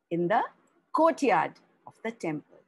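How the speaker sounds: ordinary delivery, nothing marked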